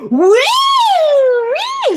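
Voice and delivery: very high, loud whooping; yelling woo. Retold or imitated. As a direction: whoop like a rowdy crowd. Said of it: "Sorry for yelling that loud".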